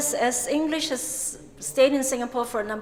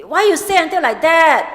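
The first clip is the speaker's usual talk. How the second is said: louder, higher Singlish, tone rising then falling; Singlish emphasis; 5 semitones higher, 7 dB louder than usual. Shown as a demonstration. Singlish speaker scolding a friend, Singlish emphasis. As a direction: hit why hard like a Singlish speaker scolding. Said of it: "they say it this way".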